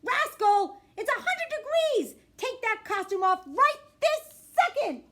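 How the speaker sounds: high, loud, slow scolding yell; mom yells. Acted. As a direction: call across the house to stop it now. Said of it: "my mom yells".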